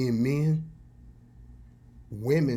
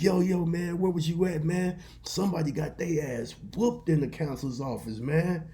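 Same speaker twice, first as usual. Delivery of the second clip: higher-pitched schoolboy buzz; excited classmates; 6 semitones higher than usual. Retold or imitated. Classmates asking him where he was, higher excited voices. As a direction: blurt out the hot gossip.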